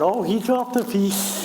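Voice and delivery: high, drawn-out gullible gasp of amazement; slow, high mock amazement; Michael impression. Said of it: "pretending Michael was going".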